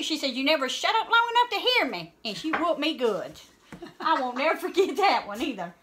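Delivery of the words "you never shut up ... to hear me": high, raised-voice rebuke; retold scolding mother. Mama to hollering child, high exasperated scolding. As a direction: scold back at a high pitch.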